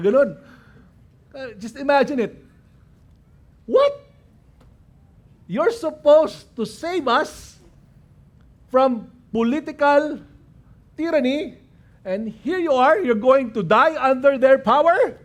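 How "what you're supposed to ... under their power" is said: high, dismayed outcry; indignant, high-pitched disbelief; incredulous protest. Acted. Disciples' imagined outraged reply to Jesus.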